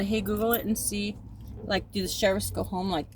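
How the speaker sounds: normal talking voice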